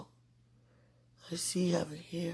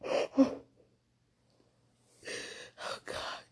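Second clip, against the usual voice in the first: hushed, breath-heavy words after crying; soft, spent, weepy murmur; tearful whisper; 7 dB quieter than usual. Real, not acted. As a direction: whisper it through real tears. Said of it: "Please excuse me for crying".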